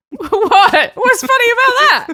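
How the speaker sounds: high, loud, laughing protest; mock offended. Real, not acted. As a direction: squawk indignantly through your own laughter.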